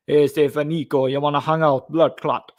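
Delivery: lightly voiced Jamaican patois, friendly call; Jamaican accent. Imitating Little Jacob inviting Niko out, in a light Jamaican accent. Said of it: "Like that guy".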